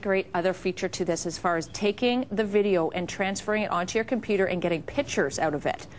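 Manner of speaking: usual speaking voice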